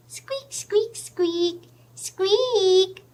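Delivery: high squeaky mouse; mouse squeaks. Acted. Teller voicing the tiny mouse, high squeaks.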